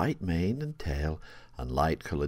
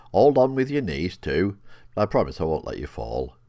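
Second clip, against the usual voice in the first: louder, firm riding instruction; firm call; 2 semitones higher, 8 dB louder than usual. A narrator's reading. The horse tells Rowan to grip with her knees, loud and firm. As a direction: call it out so she holds on.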